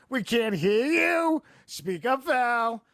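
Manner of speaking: high, drawn-out mock yell from the audience; imitated heckling yell. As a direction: imitate women calling out from the back row. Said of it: "women behind me kept yelling".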